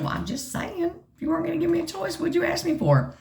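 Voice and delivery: high, fast, sassy comeback; feisty retort. Retold or imitated. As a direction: fire it back with cheek. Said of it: "You're a feisty little one".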